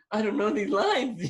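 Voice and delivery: high-pitched panic, breaking into laughter; mock panic. Actor minutes before his scene, thinking, mock panic. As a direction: play a near heart attack for laughs. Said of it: "I'm nearly having a heart attack".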